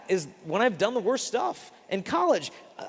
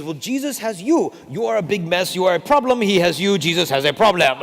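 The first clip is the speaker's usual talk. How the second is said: louder, insistent French priest; emphatic priest; 8 dB louder than usual. Retold or imitated. French priest declares Jesus has a problem, loud and insistent. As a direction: drive the point home with growing force.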